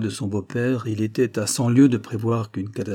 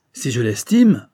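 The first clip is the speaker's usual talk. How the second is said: louder, fired-up outburst; heated exclamation; 3 semitones higher, 8 dB louder, slower than usual. A narrator's reading. Marquis bursts out hotly to his father-in-law, heated. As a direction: burst out with fervour. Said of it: "s'écria monsieur de Glandève avec chaleur".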